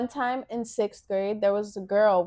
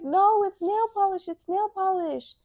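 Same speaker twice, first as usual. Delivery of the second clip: imitating girl protesting; 10 semitones higher than usual. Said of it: "She was like".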